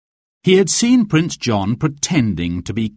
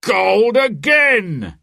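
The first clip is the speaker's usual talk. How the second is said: high, slow excited announcement; sheriff crying out; 11 semitones higher, 4 dB louder, much slower than usual. A narrator's reading. Sheriff announces both golds to the crowd, excited cry. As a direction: call the score out to the whole square. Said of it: "the sheriff cried".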